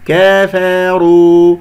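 كفروا stretched as "keh-feh-ru"; over-thinned vowels. Shown as a demonstration. Teacher shows an error, كفروا with smiling, tilted vowels. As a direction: spread the jaw in a smile on كفروا, slow. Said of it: "أجعل هذه الاستفالات أو ابتسامة في الفك".

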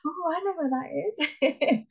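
high, sing-out mock recognition; knowing exclamation. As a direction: chime it like everyone who recognizes the place. Acting out everyone's predictable reaction.

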